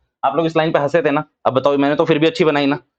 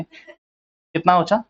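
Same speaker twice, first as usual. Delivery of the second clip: slow coaxing prompt; much slower than usual. Shown as a demonstration. Slow prompting question to class.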